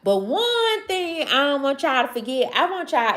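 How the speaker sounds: ordinary talk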